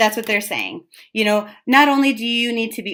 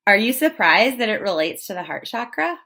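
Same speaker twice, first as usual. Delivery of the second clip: ordinary delivery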